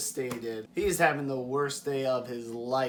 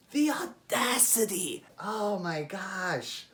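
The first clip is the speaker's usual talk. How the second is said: high, scandalized exclamation; mock outrage; 5 semitones higher, slower than usual. Real, not acted. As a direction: sound theatrically appalled. Outraged at the girl's nerve.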